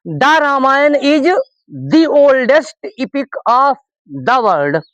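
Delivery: slow, word-by-word model sentence; slow dictation. Shown as a demonstration. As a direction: read each word out slowly for the class. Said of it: "देखिएगा कितना pronunciation में difference होगा".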